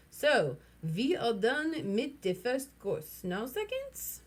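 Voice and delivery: put-on slow, high, hammy German accent; mock German diner. A narrator's reading. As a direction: play a pompous German gourmet. Billy plays a posh diner.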